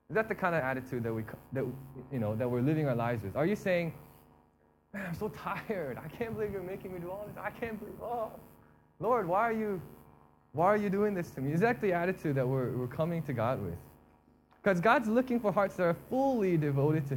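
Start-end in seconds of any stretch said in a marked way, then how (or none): 4.9-11.5 mock whine to God, higher-pitched; exasperated, hammed-up protest; weary complaint. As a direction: grumble like someone worn out and put upon.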